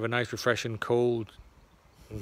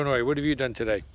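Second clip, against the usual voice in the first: usual speaking voice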